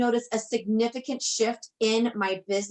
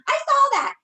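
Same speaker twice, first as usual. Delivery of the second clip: much higher and louder, thrilled; excited agreement; 7 dB louder than usual. Retold or imitated. Voicing her friend's reply, thrilled and loud. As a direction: shriek it back like a delighted friend. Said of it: "She's like".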